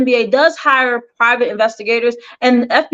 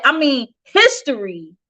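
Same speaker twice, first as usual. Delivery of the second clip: normal talking voice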